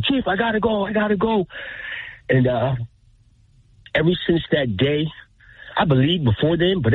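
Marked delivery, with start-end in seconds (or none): 0.0-1.5 retold scream. Pilot tells the crew chief he must go, retold scream. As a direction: imitate a pilot screaming in alarm, voice pitched up. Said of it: "He's screaming".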